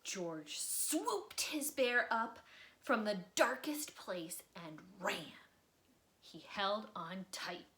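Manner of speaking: expressive read-aloud storytelling; lively children's book narrator; story narration. A narrator's reading. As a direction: read it like bedtime story time for kids. Reading a picture book aloud.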